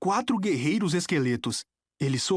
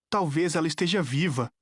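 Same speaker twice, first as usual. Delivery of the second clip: ordinary delivery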